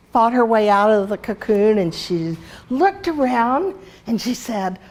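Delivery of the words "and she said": ordinary talk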